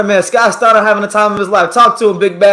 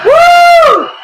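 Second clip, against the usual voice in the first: very high loud whoop; excited whoop; 7 dB louder than usual. Real, not acted. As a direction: cheer wildly. Cheering boxer's entrance.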